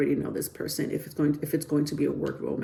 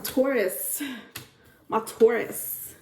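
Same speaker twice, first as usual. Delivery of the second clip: slow, higher, lingering "my Taurus"; fond drawn-out call; 6 semitones higher, much slower than usual. Real, not acted. Reader calling out to her Taurus viewers, lingering fondly. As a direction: croon "my Taurus" affectionately. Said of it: "I always have to say it like that".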